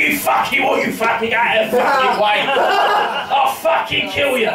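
hammed-up threat, climbing in pitch; mock aggressive Londoner. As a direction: work yourself into a mock street-fight rant.

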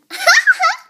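put-on high squealing toddler giggle; a shrill little-girl laugh; Boo giggle. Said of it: "Boo impression".

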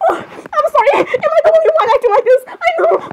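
very high, giggly protest; flustered squealing. Acted. Girls teasing each other over the clip, high and flustered. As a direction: squeal it, half laughing.

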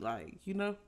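ordinary talk, nothing marked

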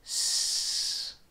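S hissed past a stiff tongue; hissy S. Shown as a demonstration. Teacher showing the air escaping on S. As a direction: hiss the S with the tongue held still. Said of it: "same core characteristic as S".

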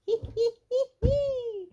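very high hee-hee giggle; high, loud mischievous titter; gleeful giggle. A narrator's reading. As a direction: giggle like a little piglet.

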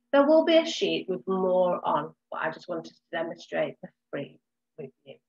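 ordinary talk, nothing marked

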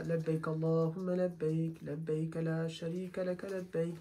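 soft, low-key recitation under the breath; quiet mumbled talbiya. Shown as a demonstration. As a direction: say it softly, the way it should not be done. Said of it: "l'homme, il va pas faire comme ça". (talbiya murmurée, à voix basse)

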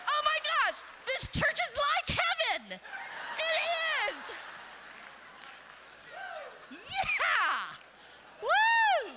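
high, loud joyful shouting; excited shout. Real, not acted. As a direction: whoop along with the cheering church. Congregation cheering, she shouts back.

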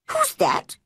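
very high, startled question; startled boy. A narrator's reading. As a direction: ask in a high, alarmed boy's voice. Boy suddenly spots a stranger.

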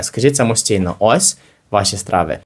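normal talking voice

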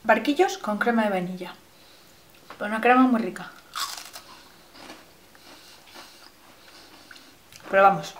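speaking between bites; chewing while talking. Real, not acted. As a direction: mouth partly full of wafer. Seen 1: Holds bitten wafer, chews between phrases, bites again at 3.1s.

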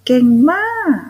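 high, loud cheer with a drawn-out มาก; excited praise. Real, not acted. Teacher praising children after practice.

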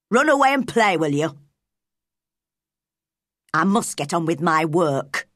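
put-on curt old Yorkshire gardener's refusal; unsmiling. A narrator's reading. Ben sends Mary away to play, unsmiling and gruff. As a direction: shut her out firmly, no smile left. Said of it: "Ben stopped smiling".

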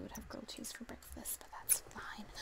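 whispered aside. Real, not acted. Amused aside about breakfast choice.

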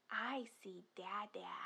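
hushed, secretive "I see"; playful whisper. Pretend. Spotting dada in picture.

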